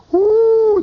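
high, loud drawn-out "whoo"; thrilled whoop. Retold or imitated. Storyteller getting a look from the nurse, thrilled whoop. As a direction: whoop at a sudden spark.

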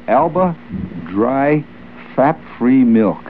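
drawn-out, deliberate "Fat-Free Milk"; mock-solemn sponsor read; slow product name. Reading the sponsor product slowly.

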